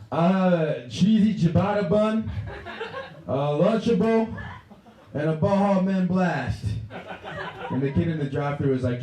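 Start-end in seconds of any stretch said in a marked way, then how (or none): 0.1-6.6 put-on high, loud, slow drunk garbling; drunken order. Drunk customer ordering at the drive-thru, high slow drunken drawl. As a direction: belt out the order with hammed-up drunken drawn-out words. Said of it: "but what I said was".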